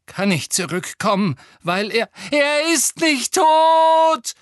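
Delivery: builds to a high, slow roar; bellowing. A narrator's reading. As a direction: roar it in denial. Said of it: "brüllte Harry".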